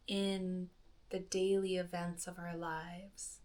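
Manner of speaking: soft, hushed meditation voice with long pauses; quiet, spacious delivery, letting each phrase settle; guided meditation. Acted. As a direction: gently guide a listener into breath awareness, softly and unhurriedly.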